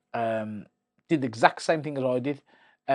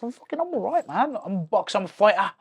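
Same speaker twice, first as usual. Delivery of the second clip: high, loud and fired up, insisting he's fine; defiant insistence; 7 semitones higher, 4 dB louder than usual. Retold or imitated. Boxer insisting to the ref he can fight, high and loud. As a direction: plead to be let fight, pitch climbing. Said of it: "I'm like".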